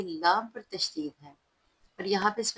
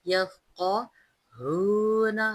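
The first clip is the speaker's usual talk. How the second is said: slow chanted verse with long vowels; tajweed recitation; 3 semitones higher, 6 dB louder, much slower than usual. Shown as a demonstration. Teacher reciting the verse for students, slow melodic chant. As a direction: chant each word slowly and melodiously. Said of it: "हा को भारी पढ़ेंगे".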